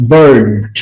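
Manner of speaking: বার্ড drawn out to a clear "d" at the end; final d sound. Shown as a demonstration. Teacher showing students how bird ends, clear final d. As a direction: sound out বার্ড so the closing "d" is heard. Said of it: "ড উচ্চারণ হচ্ছে শেষে".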